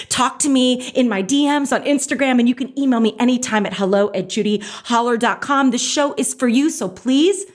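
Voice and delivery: host sign-off read; podcast outro. Acted. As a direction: address listeners warmly like a host.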